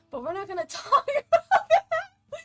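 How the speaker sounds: laughing through the words; giggly high deflection. Real, not acted. Laughing about hair change.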